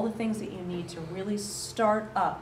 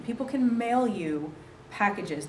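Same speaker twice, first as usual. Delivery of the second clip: ordinary delivery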